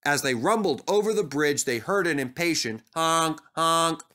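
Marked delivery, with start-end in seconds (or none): none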